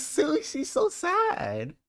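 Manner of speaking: mock pity. Real, not acted. Mock pity at crying clip.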